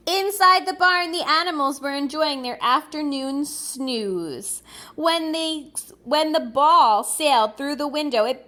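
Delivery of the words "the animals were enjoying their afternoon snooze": ordinary delivery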